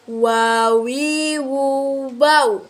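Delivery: "wawi" with both lips rounded together. Shown as a demonstration. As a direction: push "wu" through pursed lips. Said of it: "mengumpulkan dua bibir".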